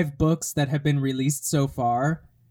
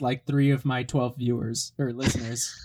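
ordinary talk, nothing marked

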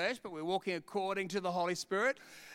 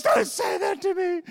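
high, loud, offended mother; indignant mum; 10 semitones higher, 7 dB louder than usual. Retold or imitated. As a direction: cry out in hurt protest. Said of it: "My mum goes".

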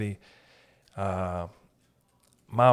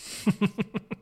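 high laugh; 9 semitones higher than usual. Real, not acted. Laughs at own line.

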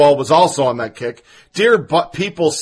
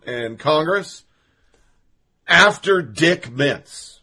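ordinary talk

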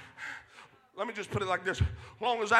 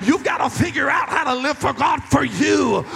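loud, rising pulpit preaching; fiery sermon voice, slowing on the last lines; full-force preaching; 3 semitones higher, 7 dB louder than usual. Acted. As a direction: preach it to the back row.

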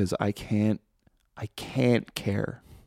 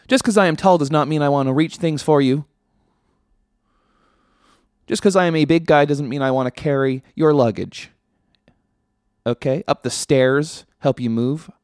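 louder, higher comic rant; mock rant; 4 semitones higher, 5 dB louder than usual. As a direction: vent like you've had enough. Ranting about being tall.

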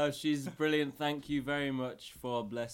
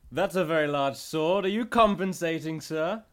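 higher and louder mocking jab; cheeky taunt; 5 semitones higher, 8 dB louder than usual. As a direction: tease the knight about his sword. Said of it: "And I say".

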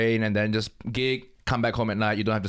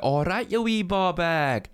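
high, drawn-out Glaswegian greeting; Glaswegian accent; 6 semitones higher, slower than usual. Shown as a demonstration. Comic performs his act-out line, Glaswegian accent. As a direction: call it out in mock Glaswegian. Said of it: "a Glaswegian thing".

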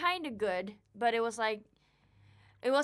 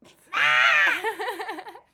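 howling burst of laughter; loud shrieking laugh; 13 semitones higher, 13 dB louder than usual. Real, not acted. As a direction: roar with high laughter. Big laugh after barking.

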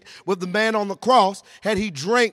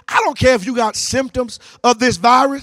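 climactic preaching; 4 semitones higher, 6 dB louder than usual. Real, not acted. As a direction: drive the congregation to a fervent peak.

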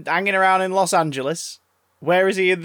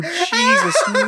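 laughing; 13 semitones higher, 4 dB louder than usual. Real, not acted. Laughing at absurd leprechaun plot.

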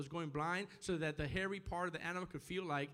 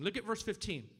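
normal talking voice

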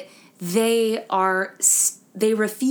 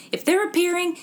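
raised, insistent mom impression; 6 semitones higher than usual. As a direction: lecture like an exasperated mom. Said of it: "she's like".

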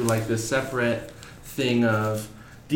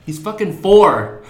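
loud, slow, higher-pitched dismay; exasperated disbelief; 6 semitones higher, 8 dB louder, slower than usual. Retold or imitated. Teacher appalled a kid knows horror movies, slow loud disbelief. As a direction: stretch it out, appalled at the child's age.

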